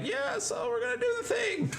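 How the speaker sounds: high, soft, easygoing put-on voice; lounging, high-pitched drawn-back delivery; laid-back character voice. Shown as a demonstration. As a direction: toss it off in a high, loose voice. Demo of laid-back posture voice.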